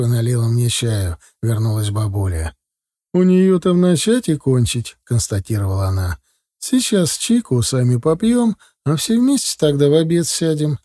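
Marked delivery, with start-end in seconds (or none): 3.2-10.8 higher-pitched grandmother, matter-of-fact; brisk grandmother. Acted. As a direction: state it plainly in a raised grandmother's voice. (бабушка, высоким голосом, по-деловому)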